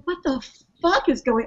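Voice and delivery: high, sudden what the fuck of alarm; jolted out of a calm drive; startled. Retold or imitated. Startled by hail on truck.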